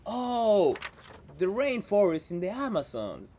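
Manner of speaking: normal talking voice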